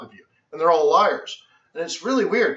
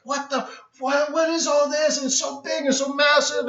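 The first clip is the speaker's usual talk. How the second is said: overwhelmed; 8 semitones higher than usual. Retold or imitated. Overwhelmed by huge room.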